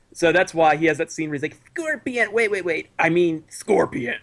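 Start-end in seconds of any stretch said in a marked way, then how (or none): 1.7-2.9 put-on high-pitched baby voice; squeaky little kid caught out of character; Sub-Zero. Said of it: "his real voice is the high-pitched baby voice". 3.0-4.2 slow fake scary voice; mock-menacing, stretched-out correction; Sub-Zero. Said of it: "doing a fake scary voice".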